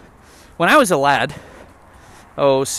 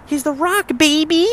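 much higher, punchy hype call; hyped wrestling announcer; 12 semitones higher than usual. Acted. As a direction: sell the name like a ring announcer. Repeated as hype.

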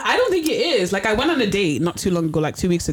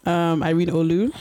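normal talking voice

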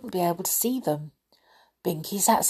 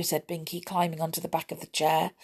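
ordinary delivery, nothing marked

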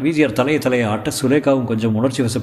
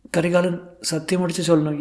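high-pitched, earnest request; Sulekha; 7 semitones higher than usual. Acted. Actress asking Karikalan to swear, high character voice. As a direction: ask for the promise in a high voice.

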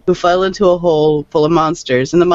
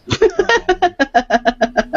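high eerie giggle, sing-song; a sinister high-pitched clown cackle; creepy clown laugh; 13 semitones higher than usual. Pretend. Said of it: "I do my creepy clown laugh".

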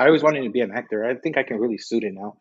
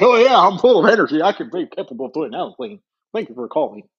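call-center voice; 6 semitones higher, 6 dB louder than usual. Pretend. Mock upbeat call-center greeting.

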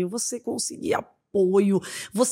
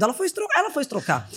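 normal talking voice

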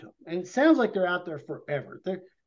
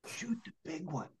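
hushed order; 2 semitones lower, 14 dB quieter than usual. Retold or imitated. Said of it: "all of a sudden he says".

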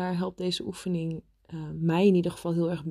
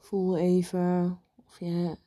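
guided meditation. Acted. Leads a meditation.

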